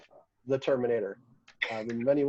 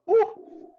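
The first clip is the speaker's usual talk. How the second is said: pained ooh; 4 dB louder than usual. Real, not acted. Burning from ghost pepper.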